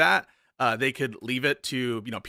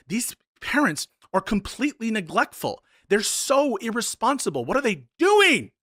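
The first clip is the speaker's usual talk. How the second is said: mock outrage building; 6 semitones higher than usual. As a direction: build from scolding to an outraged cry. Hypothetical streamer condemning anti-vax parents.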